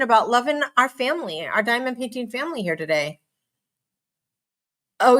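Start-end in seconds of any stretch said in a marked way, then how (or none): none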